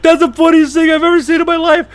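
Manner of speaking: high, loud, strained friend's voice; gleeful mocking buddy; friend laughing. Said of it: "he's like".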